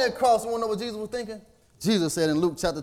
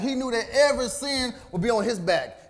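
ordinary talk, nothing marked

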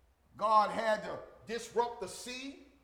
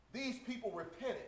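normal talking voice

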